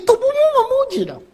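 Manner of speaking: high leaping call to praise; excited urging. Acted. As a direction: push the crowd to praise with their bodies. Preacher urges praising with body. (seruan bersemangat)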